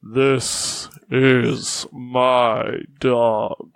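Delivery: slow, low talking tree voice. Acted. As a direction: drag each word out like an ancient tree. Tree speaks in character.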